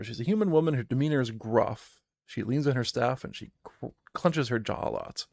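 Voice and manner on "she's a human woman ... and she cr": normal talking voice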